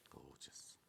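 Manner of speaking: breathless whispered Gorgeous. Real, not acted. Presenter admiring the fabric, barely whispered.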